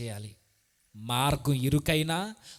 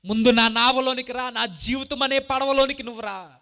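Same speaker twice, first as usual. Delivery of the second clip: higher, louder call to the Lord; loud pleading prayer; 8 semitones higher, 7 dB louder than usual. Acted. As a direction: cry out the invitation. (గట్టిగా పిలిచే ప్రార్థన)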